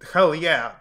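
shouted hell yeah; loud triumphant cheer. Real, not acted. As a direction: whoop it out loud. Cheering answer to own question.

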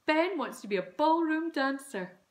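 loud, high, excited mum; chiming in. A narrator's reading. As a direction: sing it out proudly. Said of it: "Mum chimed in".

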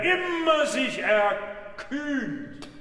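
high, very slow grand declamation; hammy stage actor. Shown as a demonstration. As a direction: stretch immer out with pathos. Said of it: "Im theatralischen Sinne".

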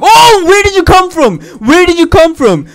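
high startled shout. Real, not acted. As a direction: jump out of your skin yelling. Monster appears suddenly.

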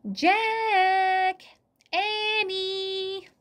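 high, drawn-out sustained call; calling from afar. A narrator's reading. Mother calls her children from the distance, calling from afar. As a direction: holler each name long and high, as if across a distance. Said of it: "mother's voice came from the distance".

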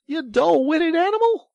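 very high, loud exasperation; impatient cry. A narrator's reading. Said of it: "cried the rat impatiently".

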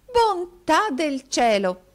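very high, loud, drawn-out exclamation; slow, swooning high-pitched outcry; exclaiming with dignity. A narrator's reading. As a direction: cry out in grand theatrical astonishment. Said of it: "esclamò la signorina Squeers".

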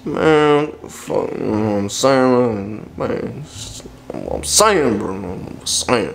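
lazy, barely decipherable ramble; unintelligible mumbling. Acted. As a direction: trail off into mushy filler. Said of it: "No one here can understand what you're saying".

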